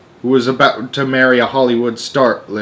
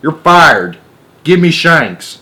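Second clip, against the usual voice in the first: angry shout; 5 semitones higher, 6 dB louder, slower than usual. Acted. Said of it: "shouted ground control".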